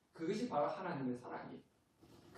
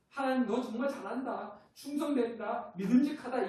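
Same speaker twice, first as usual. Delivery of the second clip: mock praise; 7 semitones higher, 6 dB louder than usual.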